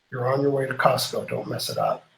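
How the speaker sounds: soft, dropping into a whisper; whispered inner voice. Retold or imitated. His inner voice warns him not to blow the Costco trip, whispered. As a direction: hush yourself, a private warning to yourself. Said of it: "And then the other voice said".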